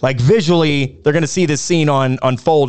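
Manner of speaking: normal talking voice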